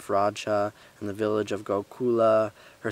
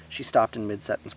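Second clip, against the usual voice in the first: plain reading voice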